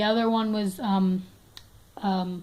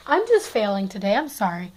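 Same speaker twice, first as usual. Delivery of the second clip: ordinary talk, nothing marked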